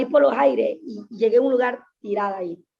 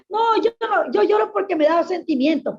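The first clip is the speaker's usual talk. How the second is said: higher, insistent denial she now mocks; mock self-excuse; 7 semitones higher than usual. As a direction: act out her old excuse, protesting. Pero mentira. (imitando su excusa, aguda e insistente)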